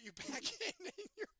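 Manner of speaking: breaking up, high-pitched; laughing while talking. Real, not acted. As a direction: voice climbing high through real laughter. Cracking up at her dream's ending.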